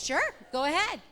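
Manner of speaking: loud, high retold cheer; exuberant agreement. Mother welcoming daughter along to church, exuberant. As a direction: agree with gusto, voice way up.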